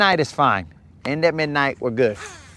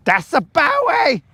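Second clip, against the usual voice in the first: excited shout; 12 semitones higher, 3 dB louder than usual. Real, not acted. Spotting a catch on stream.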